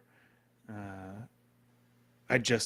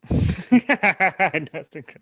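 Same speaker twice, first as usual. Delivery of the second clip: real loud, higher-pitched burst of laughter; 5 semitones higher, 11 dB louder than usual. Real, not acted. Laughing loudly at the clips.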